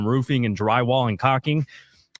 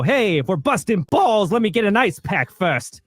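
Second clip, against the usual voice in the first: higher, hammed-up jokey bluster; lightly acted bluffer; 7 semitones higher than usual. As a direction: bluff your way through with mock bravado. Brash character bluffing after nervous laugh.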